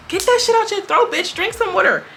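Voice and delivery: high-pitched, mock-exasperated order; exasperated scolding. Telling off the woman with the froggy voice, mock exasperation.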